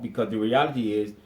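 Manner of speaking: normal talking voice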